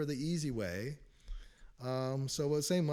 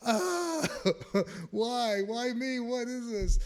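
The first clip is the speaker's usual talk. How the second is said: much higher, louder, crying out why me; anguished cry; 10 semitones higher, 7 dB louder, much slower than usual. Retold or imitated. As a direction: wail it as if dragged back into torment. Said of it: "And I'd be like".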